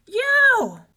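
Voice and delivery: high, very loud yelp; shout. A narrator's reading. Giant biting on a frying pan, shouting. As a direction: yell out in sudden pain. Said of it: "shouted Cuchulainn".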